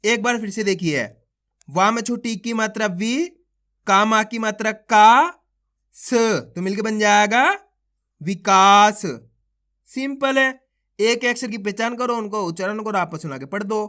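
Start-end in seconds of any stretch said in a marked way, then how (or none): 1.7-9.0 stretched-out syllable calling, each syllable punched; phonics drill. Shown as a demonstration. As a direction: sound out each syllable slowly for children, then join them. Sounding out syllables into words.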